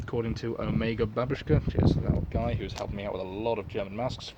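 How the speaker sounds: ordinary delivery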